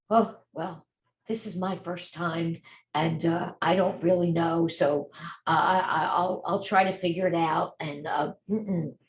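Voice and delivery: low, hesitant phone voice, full of fillers; unsure beginner. Acted. New notary fumbling a first client call, hesitant. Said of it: "speak in a confident voice, not to say".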